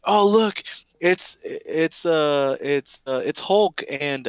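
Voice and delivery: put-on a kid who thinks help has come; excited kid. Kids spotting heroes, hoping.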